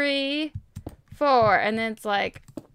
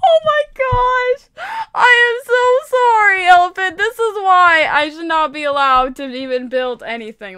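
wailing apology; 6 semitones higher, 9 dB louder than usual. Real, not acted. Wails apology to the elephant.

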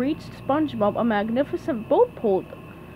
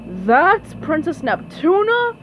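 loud, slow, raised disbelief; shouted question; 5 semitones higher, 5 dB louder, slower than usual. A narrator's reading. Someone in the crowd doubting the princess, shouting. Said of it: "someone shouted".

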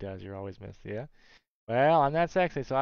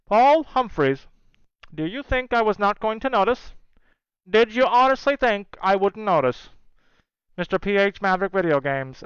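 mock stern summons; 6 semitones higher, 4 dB louder than usual. Mock stern summons of absent friend.

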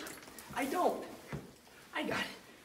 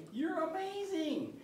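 high, slow, softer coo to a dog; doting pet praise; 12 semitones higher, 5 dB quieter, slower than usual. Real, not acted. Owner praises his dog after a catch, high doting voice.

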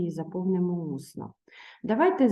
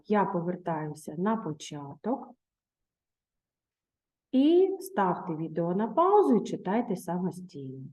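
usual speaking voice

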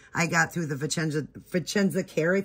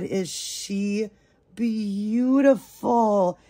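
high, slow, loud swoon; breathless adoring coo; gushing delight; 4 semitones higher, 5 dB louder, much slower than usual. Real, not acted. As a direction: stretch every word out in awe. Gushing over the doll's face and hair.

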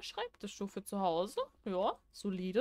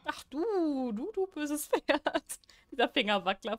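higher-pitched, drawn-out playful telling-off; mock scolding; 5 semitones higher, 4 dB louder, slower than usual. Player tells off the evil horse that scared them, mock scolding. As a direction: scold it like a naughty pet.

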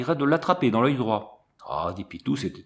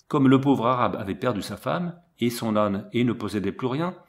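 ordinary delivery, nothing marked

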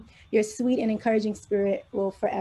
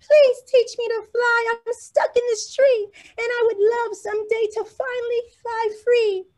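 very high, hopeful little bird plea; tiny bird longing to fly free; high bird voice, wistful; small bird voice; 12 semitones higher, 3 dB louder than usual. Acted. Birdie asks rabbit.

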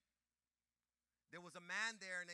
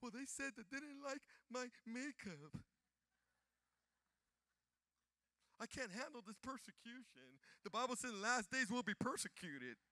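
high, mocking whiny voice; high mocking whine of a complainer; 9 semitones higher, 5 dB louder than usual.

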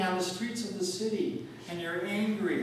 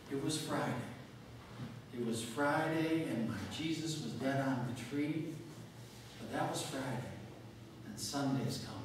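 soft start; 2 semitones lower, 7 dB quieter than usual. Retold or imitated. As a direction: begin the sermon softly, almost hushed. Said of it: "He started his sermon real softly".

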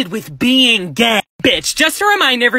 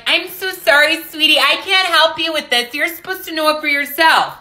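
mocking a fake sweet teacher; 3 semitones higher than usual. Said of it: "they would be like".

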